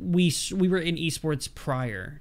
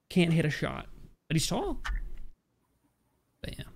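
usual speaking voice

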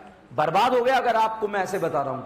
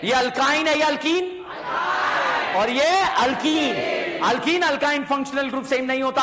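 classroom drill call; 6 semitones higher, 5 dB louder than usual. Real, not acted. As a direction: stretch the answer for the class to join.

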